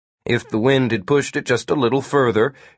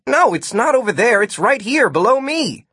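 high, impatient insistence; frustrated calling out; urgent correction; 12 semitones higher than usual. A narrator's reading. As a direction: insist in a raised pitch. Martin urgently corrects Gwen.

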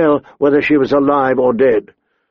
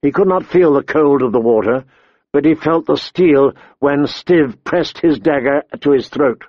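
plain reading voice

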